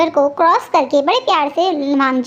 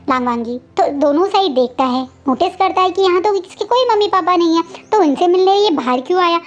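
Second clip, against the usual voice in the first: plain reading voice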